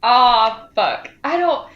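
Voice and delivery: ordinary talk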